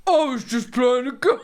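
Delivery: put-on protesting partner; toned-down husband imitation. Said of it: "Yeah, that's better".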